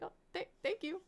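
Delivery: high-pitched stammer; flustered thanks. Retold or imitated. Streamer thanking a fan who likes her videos, flustered. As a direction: stumble over it on a squeak.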